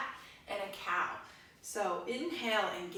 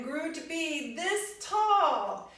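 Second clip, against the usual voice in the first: higher, louder and slow, stretching the words up; growing tall; 6 semitones higher, 6 dB louder, slower than usual. Pretend. Teacher acting out the seed growing, higher and louder. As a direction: let the voice grow tall with the tree.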